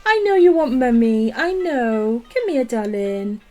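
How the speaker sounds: high, soft cooing to the dog. Real, not acted. Streamer coaxing her dog from under the desk, soft baby talk. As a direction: coax the puppy out gently.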